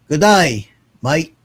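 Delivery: cheerful attempt at an Australian accent. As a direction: put on your best Down Under accent. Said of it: "my best down under accent".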